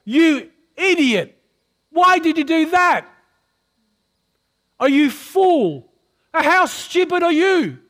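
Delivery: imitating someone tearing into another person; mock berating. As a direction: play the harsh critic to show what love avoids. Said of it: "Love doesn't say things like this".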